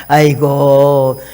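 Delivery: normal talking voice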